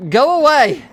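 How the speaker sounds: high, loud desperate cry; panicked shout; scared shout. Acted. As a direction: yell to drive them off, pitch jumping up. Scared, ordering away.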